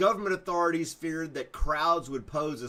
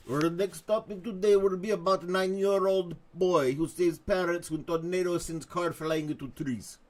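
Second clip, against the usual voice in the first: higher, hammed-up Russian accent; Soviet dictator voice; 4 semitones higher than usual. Host reading good-news headline, mock Russian dictator accent. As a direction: read it like a bad Russian announcer. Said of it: "National Talk Like a Soviet Dictator Day".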